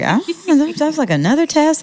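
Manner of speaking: high, lightly voiced reluctant younger self; mock reluctant self. Guest imitating her younger self dodging the LSAT, high and reluctant. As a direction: whine it off as just another test. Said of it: "I was just like".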